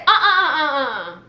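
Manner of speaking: mock string of objecting uh-uhs; dismissive uh-uh-uh. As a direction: imitate a customer cutting someone off. Customer cutting off the server.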